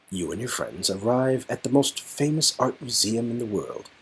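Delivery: ordinary delivery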